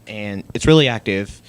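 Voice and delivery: loud emphatic repeat. Shown as a demonstration.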